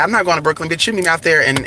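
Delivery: normal talking voice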